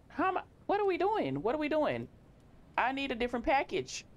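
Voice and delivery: very high-pitched exasperated squeal; exasperated outburst. Real, not acted. Confused player wanting a different package, high exasperated outburst. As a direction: let the pitch shoot up in disbelief.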